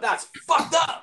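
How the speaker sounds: high, slow drawn-out yell. Retold or imitated. Retelling how he yelled at the concert, loud and high. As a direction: yell it out in outrage. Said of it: "I just yelled out literally loud".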